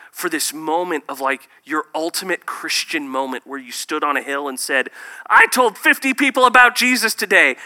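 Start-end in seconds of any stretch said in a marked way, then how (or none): 5.3-7.6 loud, high bragging claim; mock boast. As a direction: ham up a proud believer's boast.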